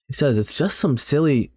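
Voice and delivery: ordinary delivery, nothing marked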